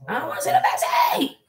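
put-on squeaky high whiny kid voice, half laughing; mock daughter scream. Mother imitating her screaming daughter, mock shriek. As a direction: mimic a bratty kid's wail. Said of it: "She decides to scream at me".

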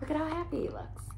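very soft, quick murmured comment; hushed aside. Real, not acted. Reader aside to child about the happy muskox, hushed and quick.

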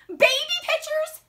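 high, loud disbelief; appalled protest. Acted. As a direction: repeat it as if scandalised. Andrew mortified, protesting.

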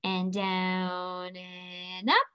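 slow, soft, drawn-out demo chant; a calm teacher tracing a letter slowly. Shown as a demonstration. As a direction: stretch each word as the hand moves. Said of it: "We're gonna go down".

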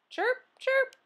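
very high cricket chirps. Acted. Classroom full of pet noises.

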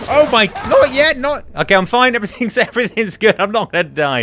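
high and loud, flustered near-death panic; panicked. Real, not acted. Gamer under fire fearing death, high panicked blurting. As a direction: blurt it out in a rush of alarm, then a nervous laugh. Gamer nearly dies in fight.

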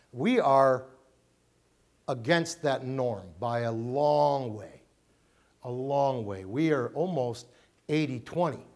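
normal talking voice

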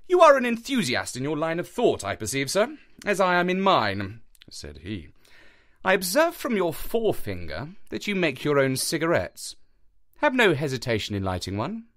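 a touch of amused Holmes. A narrator's reading. Holmes voice in read story.

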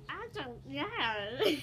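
breaking into giggles; can't stop giggling mid-sentence; laughing. Real, not acted. Giggles while trying to talk.

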